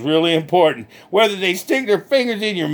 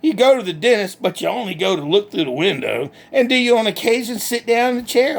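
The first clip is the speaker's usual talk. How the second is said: usual speaking voice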